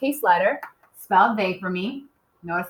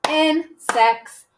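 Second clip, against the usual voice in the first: "Insects" in two separate, higher beats; clapped syllables; 6 semitones higher, 4 dB louder than usual. Shown as a demonstration. Teacher repeating the clapping exercise, "Insects" split into two beats. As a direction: beat out both syllables of "Insects". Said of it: "Two syllables".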